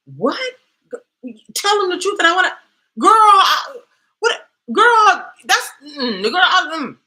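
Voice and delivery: very high, loud, spluttering shock; mock outraged disbelief. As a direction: play up a scandalized squeal, losing your words. Acted shocked reaction to calm advice.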